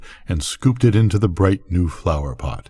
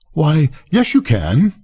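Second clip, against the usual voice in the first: eager cry; 7 semitones higher than usual. A narrator's reading. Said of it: "Lisa cried".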